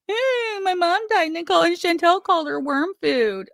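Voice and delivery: sneering imitation of a crybaby, high and quick; mocking whiny quote. Mocking Frenchie's whiny complaint.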